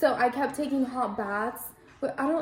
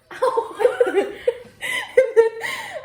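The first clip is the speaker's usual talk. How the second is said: high burst of laughter; 13 semitones higher, 7 dB louder than usual. Real, not acted. Laughs at covid test.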